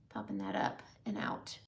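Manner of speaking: breathy whisper while blending. Real, not acted. Host whispers while blending shadow on camera. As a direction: hush it as you work the brush. Blending eyeshadow, soft commentary.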